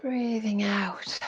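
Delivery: slow, hushed, trailing away; soft exhale cue. Shown as a demonstration. Yoga teacher guiding the class's out-breath, soft and slow. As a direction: draw it out softly like a long exhale.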